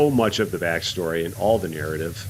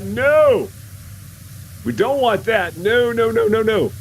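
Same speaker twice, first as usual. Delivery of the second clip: much higher and louder protest, no after no; emphatic no; 11 semitones higher, 3 dB louder, slower than usual. Pretend. Streamer protesting against having to redo the level, high and loud. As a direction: refuse the idea loudly. Rejecting feared game bug.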